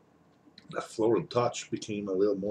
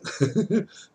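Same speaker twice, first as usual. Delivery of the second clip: pained laugh; 12 semitones higher, 4 dB louder than usual. Real, not acted. Laughs at the pepper's heat.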